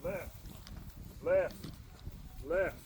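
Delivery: barked marching count; drill cadence. Shown as a demonstration. Instructor calling the step count to recruits, barked cadence. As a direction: call the step like a drill sergeant. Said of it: "You always start with the left foot".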